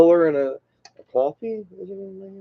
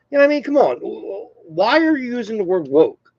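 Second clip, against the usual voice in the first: high-pitched, worked-up protest; exasperated; 8 semitones higher, 3 dB louder than usual. Real, not acted. Vlogger pissed off about the word woke, exasperated. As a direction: let the irritation push your pitch up. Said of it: "That's starting to really piss me off".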